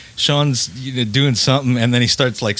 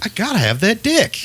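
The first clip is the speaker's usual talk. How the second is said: mock quote; 9 semitones higher than usual. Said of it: "he was like".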